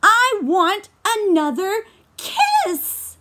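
high, loud, slow shout; loud high demanding baby. Acted. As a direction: shout it out for a kiss. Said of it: "he shouted".

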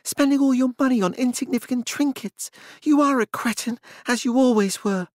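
high, sneering scorn; contemptuous. A narrator's reading. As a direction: look down on him as you speak. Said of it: "gesturing at him contemptuously".

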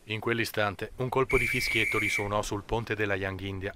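ordinary delivery

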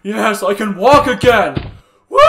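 loud triumphant whoop with a laugh; overjoyed, stretched-out cheer; elated cheer. Acted. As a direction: celebrate with a big woo. Celebrates being able to walk.

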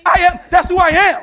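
thunderous sermon climax; full-force preaching. Real, not acted. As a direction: proclaim it to the whole church.

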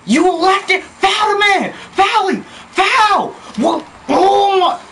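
3 yell orders at the team; 4 gamer's frantic yelling at teammates; 1 real frantic yelling. Real, not acted. Begging teammates to foul.

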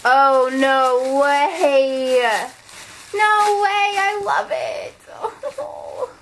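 real excited shout; thrilled yelp. Real, not acted. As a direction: burst out in happy disbelief. Thrilled opening a present.

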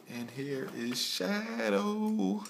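high, slow announcer reveal; dramatic reveal. Real, not acted. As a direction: announce her like a star. Toy reveal, big announcement.

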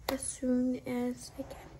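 ordinary delivery

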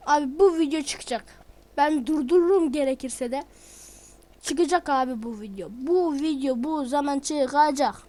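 stubborn vow hammered out; defiant shout. Real, not acted. Youtuber vowing to viewers the video will come out, loud and defiant.

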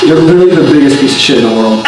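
usual speaking voice

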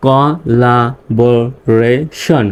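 collaboration very slow, in five separate parts; syllable by syllable. Shown as a demonstration. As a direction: split collaboration into co, la, bo, ray, shon with pauses. Teacher breaks word into syllables. (collaboration ভেঙে ভেঙে, খুব ধীরে)

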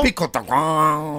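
put-on high, loud, slow Dominican accent; hammed-up Dominican accent, drawn out. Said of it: "Keenan playing Ortiz".

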